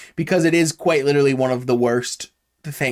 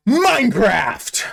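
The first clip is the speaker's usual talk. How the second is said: Minecraft drawn out slowly; title drop; 5 semitones higher, slower than usual. Shown as a demonstration. Payoff of the quoted line, slow title drop. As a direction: land Minecraft slowly as the punchline. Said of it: "then we craft".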